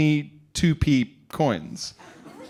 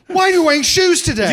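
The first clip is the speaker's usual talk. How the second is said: much higher, louder scolding; rude stranger; 13 semitones higher, 7 dB louder than usual. Retold or imitated. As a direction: scold him out of nowhere. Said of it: "hone in on you and go".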